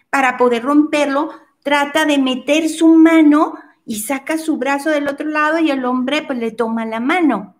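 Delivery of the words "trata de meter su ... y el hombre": usual speaking voice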